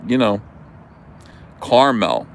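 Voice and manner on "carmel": caramel in two syllables, CAR-mel; Jersey caramel. Shown as a demonstration. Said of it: "it's caramel".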